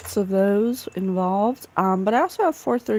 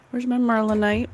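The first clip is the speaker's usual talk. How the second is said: usual speaking voice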